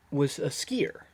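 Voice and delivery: usual speaking voice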